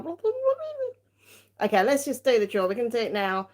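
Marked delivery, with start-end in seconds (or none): 0.0-1.7 long, high-pitched babbling whine; slow, hammed-up high moan; mock drained wail. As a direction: wail nonsense as if emotionally drained. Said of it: "drains me a little bit emotionally afterwards".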